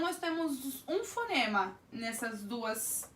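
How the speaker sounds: ordinary talk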